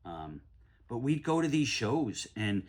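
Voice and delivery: ordinary talk, nothing marked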